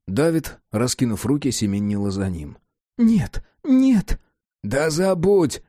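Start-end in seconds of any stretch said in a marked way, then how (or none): 3.0-4.2 put-on high, childlike pleading cry; childish plea. A narrator's reading. Girl pleading after leaving man. 4.6-5.6 shout. A narrator's reading. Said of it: "крикнул он на неё".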